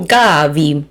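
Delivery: Gavi echoed as GA-vee with a clear V; repeating after video. Shown as a demonstration. Wine host echoing the pronunciation video, careful imitation. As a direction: copy the video's Gavi, first syllable stressed. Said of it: "I'm probably saying it wrong".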